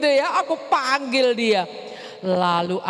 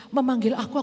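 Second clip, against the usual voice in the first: ordinary delivery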